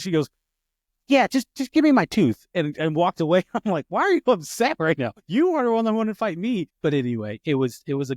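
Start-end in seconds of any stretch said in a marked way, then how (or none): 1.1-2.5 high, loud mock huffy kid; upset child. Daughter demanding her tooth from dad, mock upset. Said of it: "she's all upset". 3.9-6.7 high-pitched incredulous protest; mock exasperation. Dad to upset daughter after play fight, mock exasperated.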